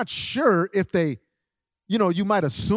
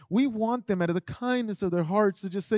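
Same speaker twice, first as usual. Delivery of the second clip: ordinary delivery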